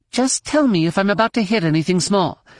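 high-pitched, impatient pilot; tense young woman pilot. Acted. Pilot asks her passenger to watch for obstacles, testily. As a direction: pitch it well up, urgent and testy.

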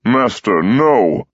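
urgent call of protest. Acted. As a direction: cry out in alarm. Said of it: "Burra called out".

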